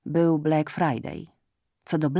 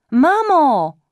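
high loud cry of mamo; shout; 9 semitones higher, 10 dB louder than usual. Acted. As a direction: yell it high and hard. Shouting at mother. (wysoki krzyk)

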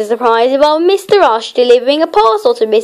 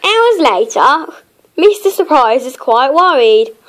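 plain reading voice

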